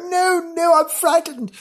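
very high, panicky horse voice; hammed-up high-pitched terror; frightened horse. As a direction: squeal it in comic fright. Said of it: "their little hooves in front of their eyes go".

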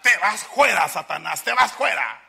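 loud, high command with "fuera" as "juera"; rustic exorcism shout. Acted. Brother orders Satan out, shouted with rustic "juera". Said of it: "su acento así fuerano".

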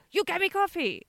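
high-pitched imitation Chinese accent; blunt demand in mock Chinese accent. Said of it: "But what it comes out as is".

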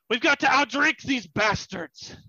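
high, loud, slowed rallying cry; bellowing captain. Acted. Captain bursting into the tavern with drinks in hand, bellowing. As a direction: holler it to the whole tavern.

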